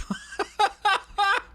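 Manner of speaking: high staccato laugh; real high-pitched laughter. Real, not acted. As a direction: crack up at your own joke. Laughs at own joke.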